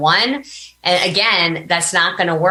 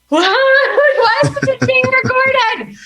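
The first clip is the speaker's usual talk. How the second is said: much higher, slow and louder, laughing in disbelief; stunned disbelief; 5 dB louder, much slower than usual. Retold or imitated. Recalling the call from Dan Kennedy, mock stunned laughing squeal. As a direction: squeal it out like you can't believe it. Reacting to the book offer.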